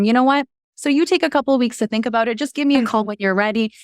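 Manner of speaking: high, fast, nervous offer. Retold or imitated. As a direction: rush it out, jittery. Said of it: "and I was a little bit nervous".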